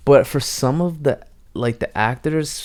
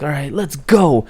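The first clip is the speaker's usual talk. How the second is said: mock determined; 4 semitones higher than usual. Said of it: "she makes it seem so important".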